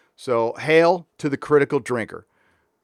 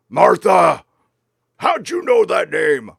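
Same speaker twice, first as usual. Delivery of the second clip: mock Doctor shouting; 4 semitones higher, 6 dB louder, slower than usual. Acting out a Doctor Who scene.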